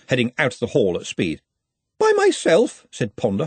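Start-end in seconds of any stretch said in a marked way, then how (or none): 2.0-2.9 very high-pitched squeak of dismay; horrified. A narrator's reading. Ponder is left in charge alone, horrified. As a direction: squeal it in alarm.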